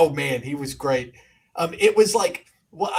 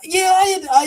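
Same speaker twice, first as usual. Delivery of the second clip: excited; 6 dB louder than usual. Real, not acted. Sudden high exclaim.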